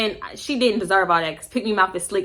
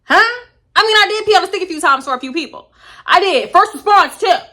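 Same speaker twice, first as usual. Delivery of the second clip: excited; 9 semitones higher, 4 dB louder than usual. Retold or imitated. Her own excited answer.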